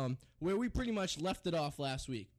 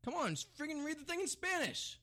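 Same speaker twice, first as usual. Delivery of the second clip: much higher, pleading push; excited urging; 10 semitones higher than usual. Real, not acted. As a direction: egg your cohost on. Coaxing cohost to read Spanish.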